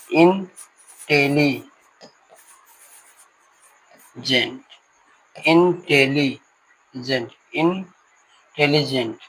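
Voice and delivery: Intelligent drawn out very slowly, syllable by syllable; intelligent said slowly, in parts. Shown as a demonstration. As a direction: stretch intelligent into its separate syllables. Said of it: "try syllable".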